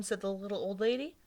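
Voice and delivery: usual speaking voice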